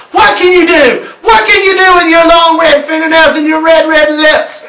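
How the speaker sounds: put-on little girl shouting back at the witch; defiant shout. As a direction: throw the witch's words back at her. Girl bravely confronts the witch.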